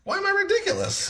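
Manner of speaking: playful indignant. Real, not acted. Playful spinach squabble.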